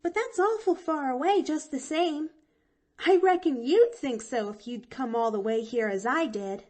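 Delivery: put-on high, eager little girl; young girl voice. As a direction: chirp it out like a bright chatty child. Girl character Pollyanna speaks.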